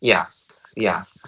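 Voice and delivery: ordinary talk, nothing marked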